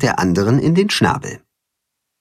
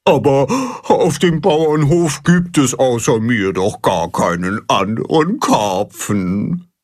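klagende Karpfenstimme, zum Ende langsamer; jammernd. Acted. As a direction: leise jammern, voller Selbstmitleid. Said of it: "jammert Baldrian". (Karpfen Baldrian jammert über seine Einsamkeit)

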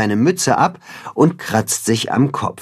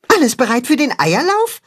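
high-pitched eager question; excited; 13 semitones higher than usual. A narrator's reading. Max checks on the egg race, excited. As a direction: ask it high, bursting to start. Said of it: "fragt Max aufgeregt".

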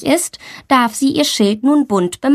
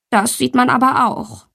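usual speaking voice

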